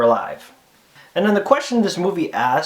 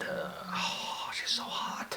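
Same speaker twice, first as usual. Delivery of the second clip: hushed, swooning aside; very soft, admiring whisper; smitten whisper; 10 semitones lower, 16 dB quieter, much slower than usual. Pretend. Smitten aside about the actress.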